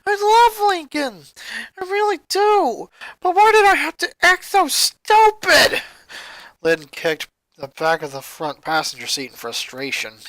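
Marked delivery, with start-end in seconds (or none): 0.1-5.8 anguished outburst. Acted. Lynn cries out in guilt to her mother in anguish. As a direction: pour out the guilt loudly.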